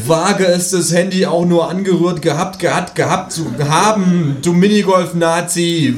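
hammed-up furious shouting; shouting threat. A narrator's reading. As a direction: shout an outraged threat at a thief. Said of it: "würde ich geschrien gehabt".

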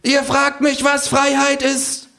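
high, slow kangaroo oration; orating kangaroo. Acted. Kangaroo on the counter lecturing the pub, high slow. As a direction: address the bar like a prophet.

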